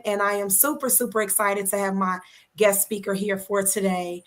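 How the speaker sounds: ordinary talk, nothing marked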